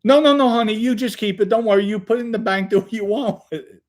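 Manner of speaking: loud, high imitation of an insistent grandma. Grandma refuses granddaughter's money, high-voiced and emphatic.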